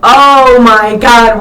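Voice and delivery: loud, drawn-out oh my God; big excited outburst; excited exclamation. Real, not acted. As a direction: gush it, leaning hard on the OH. Excited reaction about the song.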